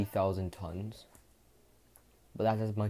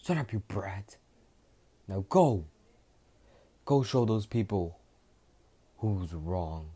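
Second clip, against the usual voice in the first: gruff send-off; 6 dB louder, slower than usual. Acted. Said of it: "Vegeta says".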